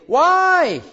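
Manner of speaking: high, loud cry of why; anguished why. Retold or imitated. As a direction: fling the question up in despair. Believer crying out to God.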